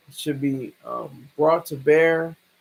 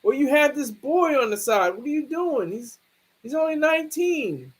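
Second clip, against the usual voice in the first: higher mock scolding of a boyfriend; mad girlfriend; 8 semitones higher than usual. Girlfriend accusing her man of a boy on the side, mock angry. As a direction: scold him like a suspicious girlfriend, pitched up. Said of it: "She was mad. She was like".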